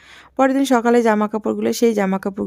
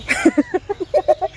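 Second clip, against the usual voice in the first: high laugh. Real, not acted. Laughing at her joke about necklaces.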